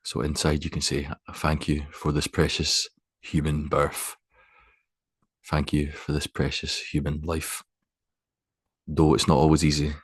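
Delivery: unhurried, spacious guidance, letting each phrase settle; guided meditation. Acted. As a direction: guide them gently into stillness, phrase by phrase.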